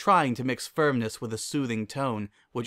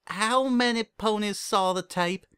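dread; 10 semitones higher than usual. Acted. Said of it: "with a hint of dread".

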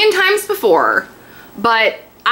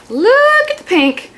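gushing; 14 semitones higher, slower than usual. Real, not acted. Gushing over nail polish.

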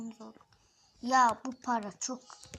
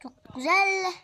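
excited cheer; 5 semitones higher, 8 dB louder than usual. Real, not acted. Excited praise.

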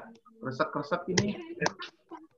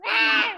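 lion roar shout; 5 dB louder than usual. Shown as a demonstration. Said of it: "Suara singa".

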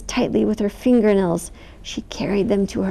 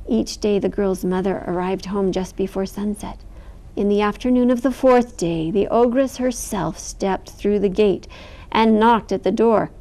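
ordinary delivery

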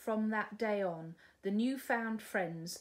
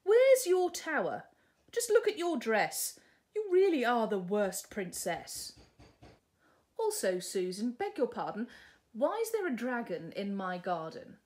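huffy prince; 2 semitones higher, 7 dB louder than usual. Acted. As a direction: berate her. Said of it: "in a huff".

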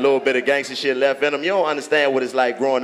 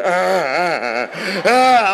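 mock panicked cries; 6 semitones higher, much slower than usual.

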